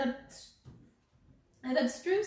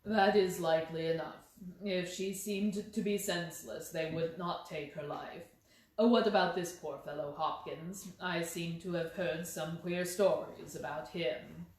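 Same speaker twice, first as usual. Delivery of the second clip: lower, measured detective voice; lightly voiced Holmes, calm and lower; 5 semitones lower than usual. A narrator's reading. Holmes coolly answering the inspector, in a lower measured voice.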